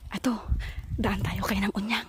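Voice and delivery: hushed whisper, sneaking; whispering while hiding. Real, not acted. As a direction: whisper so nobody hears. Sneaking up to prank neighbor. (pabulong habang nagtatago)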